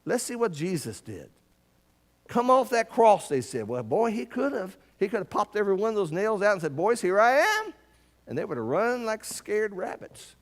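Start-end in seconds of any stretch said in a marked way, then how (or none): 2.3-3.3 loud, higher jeering call; mocking taunt. Crowd taunting Jesus on the cross, loud jeer. 6.8-7.7 loud, higher triumphant call; bold proclamation. Pretend. Jesus freed from the cross calling to the soldiers, loud and triumphant.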